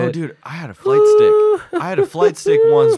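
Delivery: high, loud excited outburst. Real, not acted. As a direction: blurt it out, thrilled. Excited memory of flight stick.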